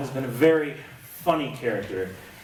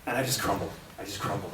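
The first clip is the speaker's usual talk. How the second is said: normal talking voice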